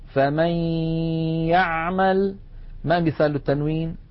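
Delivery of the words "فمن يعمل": فمن يعمل recited with a held nasal glide from فمن into the y of يعمل; nasal ghunna kept. Shown as a demonstration. Teacher asking students to note the ghunna, nasal merge in فمن يعمل. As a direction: keep the nasal hum while gliding into يعمل. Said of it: "لاحظوا بقاء الغنة".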